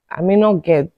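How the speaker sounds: ordinary talk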